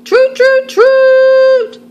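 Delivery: high, loud toot like a toy trumpet. Acted. Greta blowing a trumpet to wake Gregory, loud high toots. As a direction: blast it out like a trumpet. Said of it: "Greta blew a trumpet".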